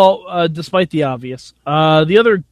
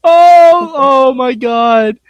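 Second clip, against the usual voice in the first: high, loud, drawn-out exclamation; delighted outburst; 8 semitones higher, 6 dB louder, much slower than usual. Real, not acted. Co-host thrilled by the kitchen nightmare nickname, delighted outburst. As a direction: burst out high and slow with real delight.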